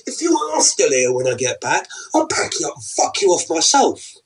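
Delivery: high, loud threatening; furious threat; angry threat. Acted. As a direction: threaten to throw her out. Husband threatens wife.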